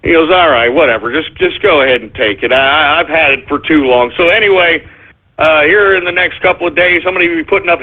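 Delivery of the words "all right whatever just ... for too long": lower, defeated shrug of a voice; resigned seller. Retold or imitated. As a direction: give in wearily.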